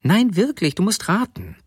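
high-pitched insistence; slightly offended. A narrator's reading. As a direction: insist on it, a little miffed. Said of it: "meinte die Frau etwas beleidigt".

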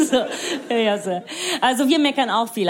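ordinary talk, nothing marked

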